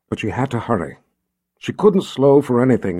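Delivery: plain reading voice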